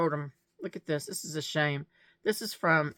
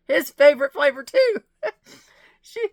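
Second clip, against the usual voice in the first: overcome, the words cracking into tears; "His favorite flavor too" breaks into real crying; 8 semitones higher, 10 dB louder than usual. Real, not acted. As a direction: voice breaking into a sob, much louder and higher. Touched by friend's note.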